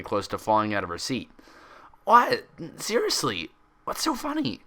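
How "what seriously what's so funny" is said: high, insistent demand. Acted. Twilight demanding Pinkie explain, high and insistent. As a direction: push for an answer, voice jumping up. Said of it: "Twilight demanded".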